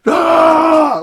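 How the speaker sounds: long high, loud held "Ah!" like a spraying can; mock spray hiss shout. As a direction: blast it out like a can of aerosol spray. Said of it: "those are the ones that are like".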